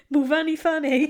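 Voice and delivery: laughing out the name; stifled giggle on the words; giggling. Real, not acted. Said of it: "I've got the giggles".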